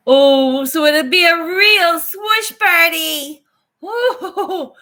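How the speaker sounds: high, loud and slow with delight; excited squeal. Real, not acted. Thrilled friend gushing about the party after the birth, high and loud. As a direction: gush over the good news, nearly crying with joy.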